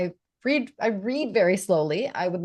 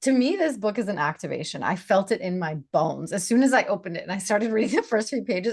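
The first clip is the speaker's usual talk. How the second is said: ordinary delivery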